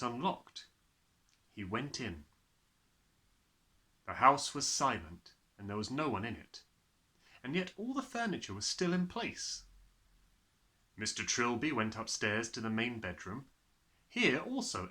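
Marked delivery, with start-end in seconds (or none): none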